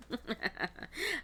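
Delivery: high giggle at herself; helpless little high-pitched laugh. Real, not acted. Reader amused by own accent, giggling.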